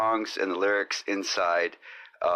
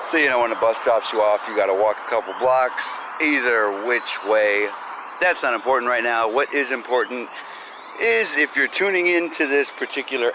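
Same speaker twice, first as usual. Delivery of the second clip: louder over the birds outside; 3 dB louder than usual. Real, not acted. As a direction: project over the outdoor noise. Loud vlog while walking outdoors.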